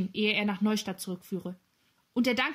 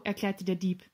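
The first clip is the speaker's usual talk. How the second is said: ordinary delivery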